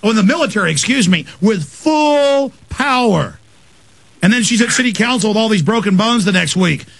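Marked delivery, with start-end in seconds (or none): 1.4-3.4 "with full power" dragged out slowly; stress on full power. Real, not acted. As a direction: lean hard on "full power". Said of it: "emphasizing force".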